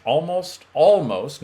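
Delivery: "Almost" hit loud and high; stress on almost. Real, not acted. Coach correcting listeners' universal language, emphatic "almost". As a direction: punch each "almost" so nobody misses it. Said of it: "notice I'm saying almost".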